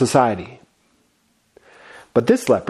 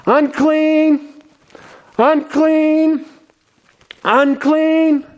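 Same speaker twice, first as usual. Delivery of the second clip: desperate leper's cry; 11 semitones higher, much slower than usual. Acted. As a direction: cry out like an outcast warning the crowd. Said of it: "he's crying out".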